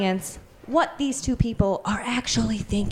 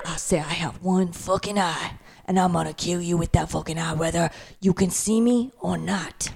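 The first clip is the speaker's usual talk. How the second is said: lower, menacing movie villain; Samuel L. Jackson; 3 semitones lower than usual. Acted. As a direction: growl the threat like a hitman. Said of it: "We're doing a Quentin film".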